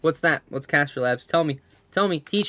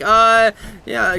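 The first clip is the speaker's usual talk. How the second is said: awkward grandpa; 8 semitones higher, 9 dB louder than usual. Retold or imitated. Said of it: "He was like".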